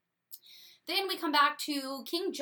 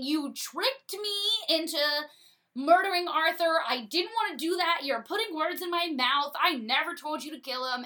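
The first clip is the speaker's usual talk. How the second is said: a touch higher, mildly louder accusation; mock yelling king; 5 semitones higher, 5 dB louder than usual. King John mock yelling at Hubert, mildly louder. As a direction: blame Hubert in a half-hearted rant. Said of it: "King John yells at Hubert".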